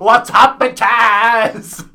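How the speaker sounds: loud and high, cheeky hype; brash loud greeting. Pretend. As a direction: greet the train like a party host. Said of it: "she was like".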